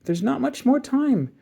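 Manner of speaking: put-on high, slow child's warning; worried little boy; boy warning time is short, high and slow. A narrator's reading. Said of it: "shouted the little boy".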